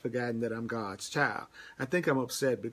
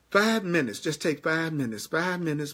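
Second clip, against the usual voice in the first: higher and louder, driving it home; urgent exhortation; 4 semitones higher, 4 dB louder than usual. Real, not acted. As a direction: plead with them to take just five minutes.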